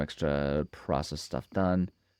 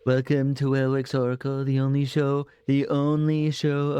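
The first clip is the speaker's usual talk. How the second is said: high, theatrical psychic host voice; mystic fortune teller; 7 semitones higher than usual. Pretend. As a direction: announce it like a TV psychic.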